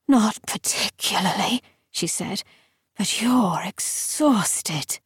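an ancient creature toying with prey; eerie whispering lictor. A narrator's reading.

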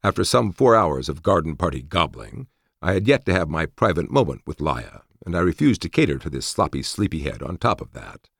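usual speaking voice

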